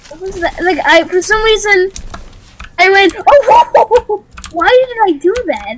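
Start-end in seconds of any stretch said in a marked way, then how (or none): 3.2-4.2 higher, louder startled whoas; weird startled whoa. Retold or imitated. Replaying the weird sound he made when startled, startled whoas. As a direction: blurt out repeated startled whoas. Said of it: "such a weird sound I made".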